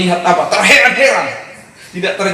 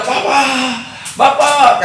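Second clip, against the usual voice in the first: calling out; 9 semitones higher, slower than usual. Retold or imitated. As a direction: call for father as if he's far away. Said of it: "kami teriak-teriak".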